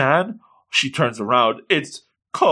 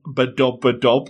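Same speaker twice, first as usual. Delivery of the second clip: heartbeat noise. Pretend. Imitating a heartbeat for blushing.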